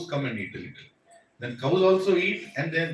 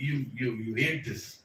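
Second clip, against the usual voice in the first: ordinary talk, nothing marked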